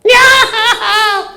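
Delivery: high drawn-out fake wail; mock funeral wail. Preacher shows how funerals sound, mock wailing. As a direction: wail like a hammed-up hired mourner. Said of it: "¿Cómo son los funerales?".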